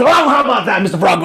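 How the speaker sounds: high, loud storybook crowing; excited holler to a rival; triumphant shout. A narrator's reading. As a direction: burst out bragging after a great leap. Tiger crows after his leap.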